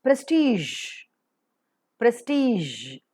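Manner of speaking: "prestige" as press-teezh, long ee; correct pronunciation. Shown as a demonstration. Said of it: "it is prestige".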